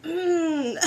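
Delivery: disappointed hum then a laugh; hum, then laugh at yourself; groan into a laugh. Real, not acted. Morning voice check, laughs.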